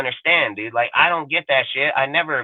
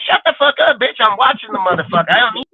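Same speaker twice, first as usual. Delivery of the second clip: high-pitched, exasperated retort; mock irritated fan; 7 semitones higher than usual. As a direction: act out snapping at a noisy neighbor. Fan snapping at a stadium ranter.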